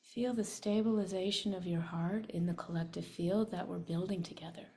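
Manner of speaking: whispered meditation guidance. Shown as a demonstration. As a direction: breathe the words without voice. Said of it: "make it silent".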